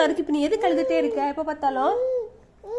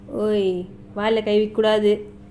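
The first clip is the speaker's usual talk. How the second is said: suddenly much lower-pitched warning; mild scolding; 7 semitones lower than usual. Real, not acted. Correcting baby's hand in mouth. (குரலைத் தாழ்த்தி லேசாக அதட்டுதல்)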